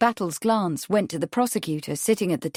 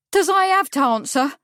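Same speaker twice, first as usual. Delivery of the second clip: high, loud demand; 8 semitones higher, 5 dB louder than usual. A narrator's reading. Witness appealing to the judge for rescue, demanding. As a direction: demand it in a raised, indignant witness voice. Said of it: "she demanded".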